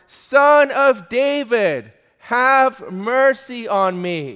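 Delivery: desperate shout. A narrator's reading. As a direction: call out for mercy with all your strength. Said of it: "He shouted it all the more".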